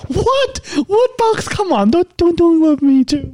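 high, shocked squeal; mock surprise. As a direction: act playfully betrayed by the dog. Mock outrage at dog's answer.

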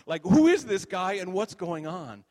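loud, high, slow incredulous question; retold exasperated questioning; baffled demand. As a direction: act out a bewildered angel, loudly. Baffled angel questions Bob loudly.

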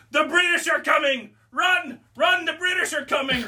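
high shouted warning, hammed up like a town crier; mock alarm shout. Prankster runs past the customer, shouting a mock warning. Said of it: "I screamed out".

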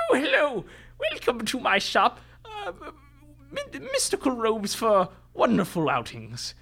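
high, squeaky little shopkeeper; squat little tailor voice. Acted. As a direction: chirp it like a fussy tiny tailor. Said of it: "speaks in a voice like this".

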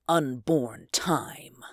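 breathy menacing whisper; ominous whisper. Acted. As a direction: hush it like a dark secret. Villain's ominous finale.

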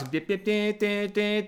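high sung fight-theme noise; imitated Star Trek battle music; Cable Guy fight music. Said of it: "like that Cable Guy scene".

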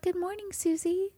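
high, soft sing-song birdie voice; cartoon princess. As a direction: coo like a fairy-tale songbird. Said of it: "You're so".

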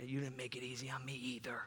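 unvoiced whisper aside. Parent whispering a jab at their child in church, hushed. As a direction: whisper it sideways to your kid. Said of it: "don't just turn to your kid".

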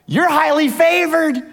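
angel bursting in on Mary's bedroom, thrilled to greet her; excited angel. Acted. Gabriel greets the teenage Mary with high excited joy. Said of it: "Gabriel showed up through the wall and said".

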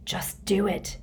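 whispered to himself; urgent whisper under breath. A narrator's reading. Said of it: "he told himself".

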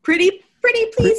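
high, fast, pleading. Pretend. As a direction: beg sweetly in a high voice. Playful begging.

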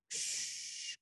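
soft hissed ksh like surf breaking; whispered wave crash. Retold or imitated. Host imitating waves on the beach, soft hiss. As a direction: breathe out the sound of waves. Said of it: "cats just crashing again".

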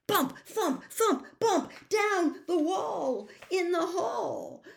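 high, loud, slowed for effect; excited narration. A narrator's reading. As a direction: bounce the words like the thumps. Narration acts out chaos.

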